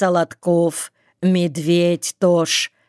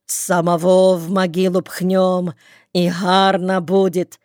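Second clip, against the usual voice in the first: ordinary delivery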